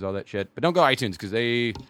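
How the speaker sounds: ordinary talk, nothing marked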